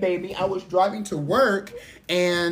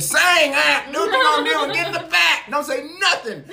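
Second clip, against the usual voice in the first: bossy mock order; 11 semitones higher, 5 dB louder than usual.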